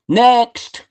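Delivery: a sudden high, clipped "Next."; curt dismissal. Retold or imitated. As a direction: dismiss them in one word, like a producer. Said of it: "But Mickey Mo said".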